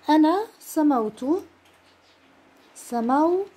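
سموت said slowly, the vowels drawn out; a teacher dictating to students; slow dictation. Shown as a demonstration. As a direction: sound out أنا سموت for writing. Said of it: "بنحط الحركات".

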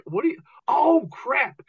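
high, panicked oh crap; sudden high-pitched dismay; panicked realization. Retold or imitated. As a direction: voice jumps high as it dawns on him. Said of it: "I go".

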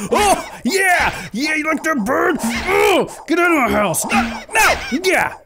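high, excited shouting; overexcited. Real, not acted. Gamer yelling at Flappy birds to get out, overexcited. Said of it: "I got too excited".

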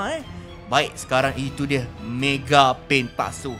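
normal talking voice

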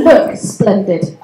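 usual speaking voice

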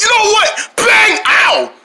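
ordinary talk

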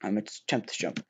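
usual speaking voice

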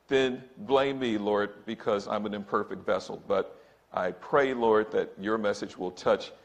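ordinary talk

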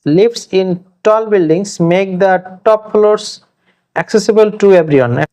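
slow dictation. Shown as a demonstration. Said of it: "example sentence".

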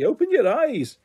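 usual speaking voice

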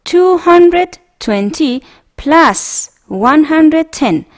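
slow, clear model reading for students to repeat. Shown as a demonstration. Teacher reading a math problem aloud to the class, slow and clear. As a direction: read it out slowly, word by word.

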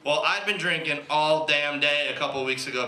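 ordinary talk, nothing marked

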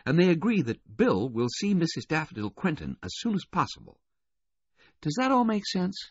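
high, lilting woman's voice; Missus Baudelaire. A narrator's reading. Missus Baudelaire reporting news to the investigator, in a high voice. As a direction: relay the news in a high, feminine register.

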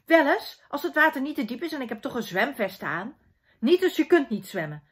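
acted bickering, punchy loud openings; lightly acted squabble; squabbling children. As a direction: play a sibling spat, hitting the first words hard. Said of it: "geen geruzie".

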